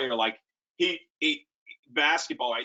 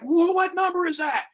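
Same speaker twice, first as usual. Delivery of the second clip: high, louder irate shout at the field; angry yell; 5 dB louder than usual. Retold or imitated. Coach yelling at the sideline after a late hit, irate. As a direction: demand the player's number in outrage. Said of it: "I am screaming".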